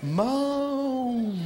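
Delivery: very slow, drawn-out urging; hammed-up slow nagging neighbor; slow mock neighbor. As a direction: stretch each word like a pushy seatmate. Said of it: "le gars, il va".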